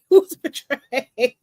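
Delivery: "He was betrayed" said slowly through a laugh. Real, not acted. Laughs after ranting.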